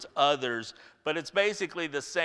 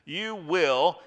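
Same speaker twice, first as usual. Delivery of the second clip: "will" pressed firm and certain; a certainty in "will"; emphatic will; 2 semitones higher, 3 dB louder than usual. Shown as a demonstration. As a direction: lean hard on "will". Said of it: "rather emphatically".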